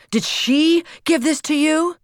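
high, loud demanding question; angry shout. A narrator's reading. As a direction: shout the accusation at the child. Father keeps shouting at daughter.

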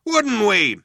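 high, loud question; calling out. A narrator's reading. Cop asking the other one for backing, calling out. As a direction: call it over to your partner. Cop calls to his partner.

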